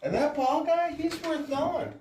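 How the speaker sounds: mocking impression. Mocking husband's eager words.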